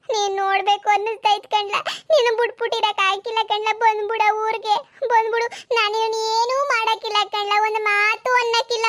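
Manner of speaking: high-pitched, aching entreaty; mother's anguished appeal; desperate pleading. Acted. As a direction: beg your child to come home. Mother begs son to return.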